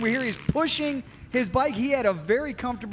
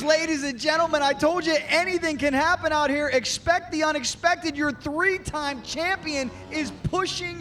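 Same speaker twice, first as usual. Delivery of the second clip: high, urgent breaking-news call; excited announcer; 6 semitones higher than usual. Real, not acted.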